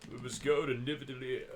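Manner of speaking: a hint of a grand god; lightly voiced heroic declaration; Thor. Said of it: "Specifically as Thor".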